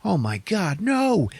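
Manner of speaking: high, rising viewer outcry; acted dread at a hero's death; mock alarm. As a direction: react in hammed-up horror. Voices alarmed viewer reacting.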